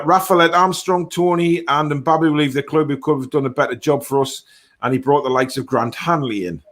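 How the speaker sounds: usual speaking voice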